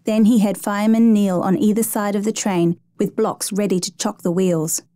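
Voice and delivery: usual speaking voice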